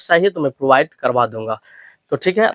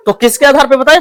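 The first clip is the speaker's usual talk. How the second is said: ordinary talk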